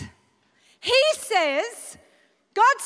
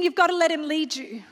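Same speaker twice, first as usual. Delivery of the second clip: normal talking voice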